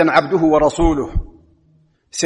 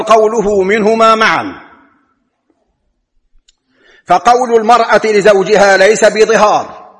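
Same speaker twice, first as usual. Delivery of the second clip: insistent Friday sermon voice; forceful preaching; 4 semitones higher, 4 dB louder than usual. Acted. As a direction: preach the ruling from the pulpit with force.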